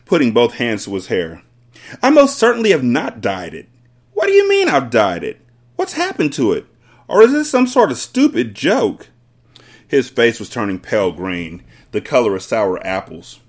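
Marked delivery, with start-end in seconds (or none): 2.0-9.1 high, emphatic denial; exasperated, raised-voice demand; indignant yelling. A narrator's reading. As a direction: protest loudly as the outraged father. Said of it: "the father yelled".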